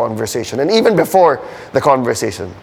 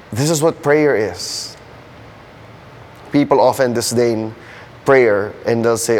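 ordinary talk

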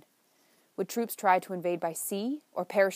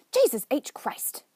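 slow, loud, higher outburst of disgust; exasperated oath; 6 semitones higher, 6 dB louder, slower than usual. Acted. Chuck finds the sink full of dishes, appalled slow oath. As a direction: draw it out, appalled at the mess.

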